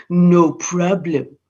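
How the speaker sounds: slow, low, smug reply; smug husband brushing it off. Acted. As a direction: drawl it out, full of himself. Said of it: "feeling so smug, he said".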